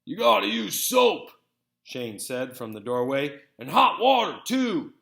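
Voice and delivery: a hint of a know-it-all brother calling out; Shane, big brother. A narrator's reading. Brother calls advice from the doorway, lightly voiced.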